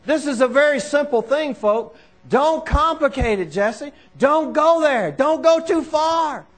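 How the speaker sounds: high, insistent pulpit delivery; forceful preaching. Real, not acted. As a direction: preach it with urgent, punched repetition.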